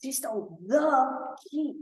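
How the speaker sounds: slow, "the" stretched before "key"; stress on the. Shown as a demonstration. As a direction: linger on "the", making it the one and only. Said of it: "the important thing in the undertone here".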